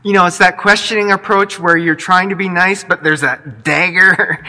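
normal talking voice

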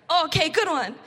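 playful praise. Retold or imitated. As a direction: hype up the kid's made-up word. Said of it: "I was like".

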